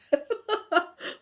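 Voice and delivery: bursting into a high-pitched laugh. Real, not acted. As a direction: crack up at yourself. Laughs at her own wild hair.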